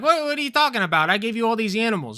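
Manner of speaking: put-on high, incredulous Italian accent; Italian God voice. God mocks lonely Adam, high Italian accent. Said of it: "and he's like".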